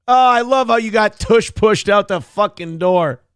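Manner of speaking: ordinary talk, nothing marked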